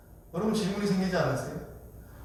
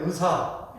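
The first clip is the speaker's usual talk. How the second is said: "의사" said as eu-sa, Jeolla style; Jeolla pronunciation; 3 dB quieter, much slower than usual. Shown as a demonstration. Preacher shows Jeolla speakers saying doctor, Jeolla eu-sa. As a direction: Jeolla accent, dropping the i glide in "의사". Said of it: "의 발음이 잘 안 돼요".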